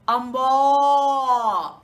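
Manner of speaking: loud lowing amba; imitated cow sound. Shown as a demonstration. Sharing her language's cow call, loud and lowing. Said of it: "the sound that the cow makes is".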